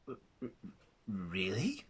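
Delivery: stammering, very soft, whispered disbelief; barely able to get the word out; stunned whisper. Acted. Secretary stunned by kindness.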